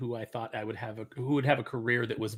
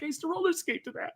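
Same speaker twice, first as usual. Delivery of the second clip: very high, softer put-on voice; high-pitched mock innocence; naive friend; 14 semitones higher, 5 dB quieter than usual. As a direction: imitate a friend who innocently missed the meaning. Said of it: "She was very naive".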